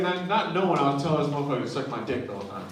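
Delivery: usual speaking voice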